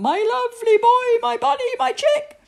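high, loud, doting cries; frantic motherly endearments; calling out. A narrator's reading. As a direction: cry out pet names to the boy. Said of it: "called Mum".

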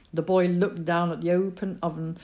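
usual speaking voice

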